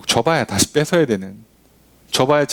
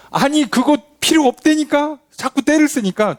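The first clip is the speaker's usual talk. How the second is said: exasperated God; 11 semitones higher than usual. Acted. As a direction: scold a child who keeps nagging. 화난 하나님 목소리. (떼쓰는 백성에게 짜증 난 하나님)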